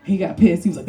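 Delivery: ordinary talk, nothing marked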